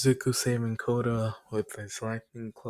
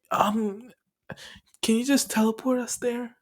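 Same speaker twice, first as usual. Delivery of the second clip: high, halting plea, imitated; acted-out timid asking; sheepish request; 11 semitones higher than usual. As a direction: ask high and hesitant, with pauses. Group sheepishly asking for help.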